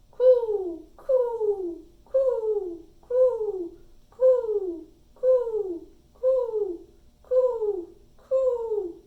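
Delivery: high, loud falling coos; loud mourning dove cooing. A narrator's reading. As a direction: coo like a dove, each call sliding down. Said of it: "cooing to one another".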